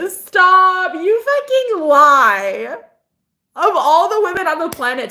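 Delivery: high, loud, slow playful protest; flattered disbelief. Retold or imitated. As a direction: squeal it like you're blushing.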